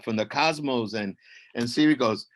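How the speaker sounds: usual speaking voice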